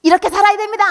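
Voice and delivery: high, loud preaching at the crowd; mock preachy singer. Daughter mocking how gospel singers must preach to people, high and loud. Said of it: "씨씨엠 가수 하면 이렇게 돼야 돼".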